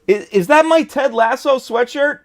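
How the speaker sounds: high, loud playful outrage; mock indignant at the theft; surprised. Real, not acted. Spots wife in his sweatshirt.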